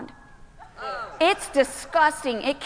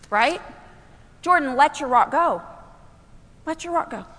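usual speaking voice

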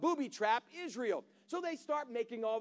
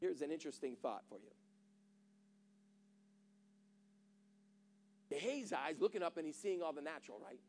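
much softer, lower and fast; quiet aside; 4 semitones lower, 9 dB quieter, faster than usual. Real, not acted. Preacher confiding to the congregation, hushed. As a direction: drop the voice to a near murmur.

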